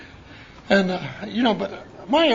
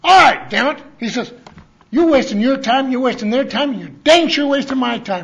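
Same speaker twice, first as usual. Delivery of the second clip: higher and much louder; old man yelling at a newcomer pinned to a fridge; angry yell; 5 semitones higher, 7 dB louder than usual. Retold or imitated. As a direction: give him a furious dressing-down. Nick pinning him, yelling.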